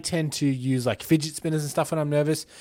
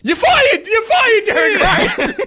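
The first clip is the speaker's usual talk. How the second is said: high, loud firing shout; 9 dB louder, slower than usual. Pretend. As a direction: scream the firing. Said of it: "discipline someone".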